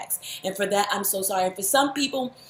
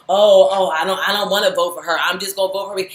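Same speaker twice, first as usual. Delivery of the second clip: mocking begrudging voter; 8 dB louder, faster than usual. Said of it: "put up a status begrudgingly".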